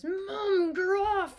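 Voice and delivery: high, loud whiny wail. Acted. Ron squirming from his mother, loud whiny wail. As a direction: wail to be let go. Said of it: "he wailed".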